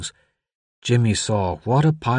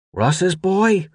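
higher voice, dawning recognition; surprised deputy; 8 semitones higher than usual. A narrator's reading. Deputy on the radio places the boy, surprised. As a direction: work out who the caller is. Deputy works out who is calling.

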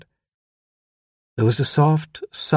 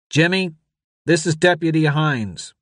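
raised, official deputy voice; identify yourself firmly to a child; deputy on radio; 6 semitones higher than usual. A narrator's reading. Deputy introduces himself to the boy, firm. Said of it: "the voice said".

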